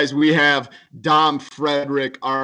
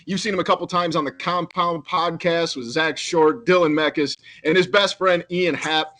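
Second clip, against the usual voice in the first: announcer intro; 4 semitones higher than usual. Acted. Podcast host introducing guest.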